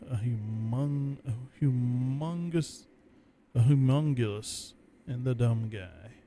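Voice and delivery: ordinary talk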